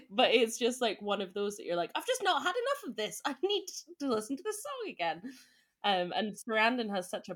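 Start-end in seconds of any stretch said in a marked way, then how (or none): 2.0-5.2 high-pitched, hammed-up pleading; acted craving. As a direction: play the fan who desperately needs more. Acts out an obsessed listener.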